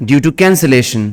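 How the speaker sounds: slow, word by word dictation; dictating. Acted. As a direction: dictate slowly for students to write. Dictation after new line instruction.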